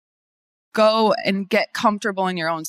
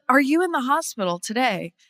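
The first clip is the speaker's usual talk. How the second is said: higher, put-on video host voice; on-camera ad pitch; 4 semitones higher than usual. Lawyer addressing hospital patients in her ad, higher promo voice.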